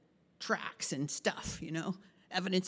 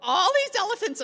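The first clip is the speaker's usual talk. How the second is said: excited; 14 semitones higher, 9 dB louder than usual. Retold or imitated. Sudden elephant alarm.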